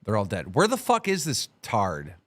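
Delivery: normal talking voice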